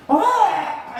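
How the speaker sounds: high loud cry while fleeing; panicked yelp. Retold or imitated. King fleeing for his life, crying out. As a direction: yelp as you run for your life.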